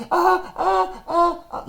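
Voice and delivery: put-on high, thin cries of pain from a hurt boy; pained whimper. Hurt boy whimpers high while his aunt checks his shoulder. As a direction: whimper high like a kid who can't be touched. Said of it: "I'm hurting".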